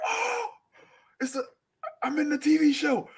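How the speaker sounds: high, squealing delight; thrilled kid. Retold or imitated. Kid watching the TV shake, squealing high with joy. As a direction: gasp like you're inside the show. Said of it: "I'm like".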